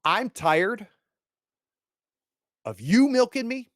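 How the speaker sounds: high, loud furious father; father shouting at Curtis in anger; angry shout. Acted. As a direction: yell it at the freeloader. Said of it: "he goes".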